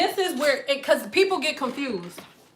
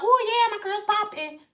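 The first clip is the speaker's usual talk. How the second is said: mock proud woman; 7 semitones higher, 4 dB quieter than usual. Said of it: "you just back in the house like".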